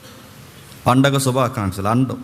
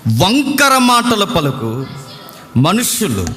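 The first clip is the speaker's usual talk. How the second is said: raised, slow, solemn Bible reading; solemn raised scripture reading; 5 semitones higher than usual. Acted. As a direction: proclaim each verse slowly. Reads scripture aloud.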